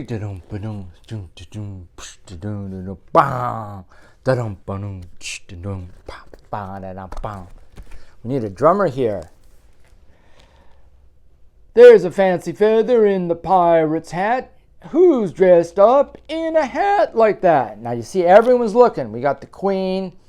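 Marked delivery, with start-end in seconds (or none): 0.0-7.5 quiet mouth drumbeat; beatbox the drums softly; soft vocal drumming. Acted. Reader drums the refrain quietly for the kids. Drum refrain in storybook reading. 11.7-19.1 loud, lilting picture-book read; reading to children. A narrator's reading. Parent reading a Halloween book aloud, sing-song.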